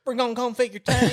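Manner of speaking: very high hammed-up country twang; mock Southern accent. Friend parodying a tank call, high Southern accent. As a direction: correct yourself into exaggerated Southern talk. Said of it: "Let me correct myself".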